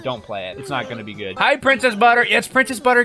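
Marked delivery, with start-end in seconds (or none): 0.0-1.4 low, much softer, flat verdict; quiet deadpan warning. Retold or imitated. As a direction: mutter it like a jaded gamer. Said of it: "I'm like".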